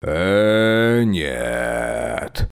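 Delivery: low snorting refusal. A narrator's reading. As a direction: snort it off dismissively. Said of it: "фыркнул он".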